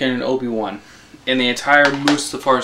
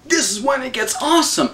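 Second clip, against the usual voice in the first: excited fan; 4 semitones higher than usual. Retold or imitated. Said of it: "was just like".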